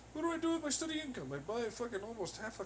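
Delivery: high, fast sneering mimicry; mocking high voice. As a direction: squeak it out like a mock idiot. Mocking a man's boasting.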